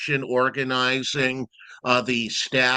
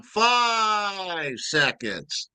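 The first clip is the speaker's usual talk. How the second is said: higher, very slow stretched call; drawn-out time call; 7 semitones higher, much slower than usual. Shown as a demonstration. Timekeeper demonstrates the shortened call for the host. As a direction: draw out five seconds like in the ring. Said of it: "They told me to shorten that just a little".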